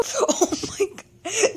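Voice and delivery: high, slow laughing oh my God; real stunned laughter at the glitch. Real, not acted. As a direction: laugh it out in shocked disbelief. Glitch surprises him.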